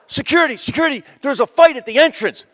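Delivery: high, urgent call for help; imitated urgent alarm. People run up to supposed security man, urgent alarm. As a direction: raise the alarm, acting a panicked bystander.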